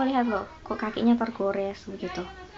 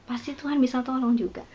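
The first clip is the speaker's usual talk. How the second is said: ordinary talk, nothing marked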